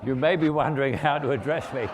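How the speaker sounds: laughing pretend grandeur; mock letter to Jude. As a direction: parody a lordly letter, on the edge of laughter. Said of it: "supposing I sent Jude a, a letter".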